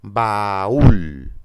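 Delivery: "Baúl" drawn out, loud stress on the "ú"; "Baúl" in two syllables, leaning hard on "úl"; stressed hiatus. Shown as a demonstration. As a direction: pull "Baúl" apart into "ba-ÚL". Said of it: "la tónica es la cerrada".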